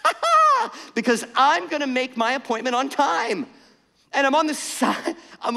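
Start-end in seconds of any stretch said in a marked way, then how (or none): 0.0-0.9 a sudden high mock "ha ha" at the stuck cars; cheeky triumphant cackle; gloating laugh. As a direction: laugh out loud at them, much higher and louder. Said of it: "looked up at the cars on the ramp and went".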